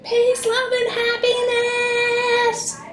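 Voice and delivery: high, drawn-out yell; long, high holler held on the last word. Real, not acted. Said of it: "Yell it".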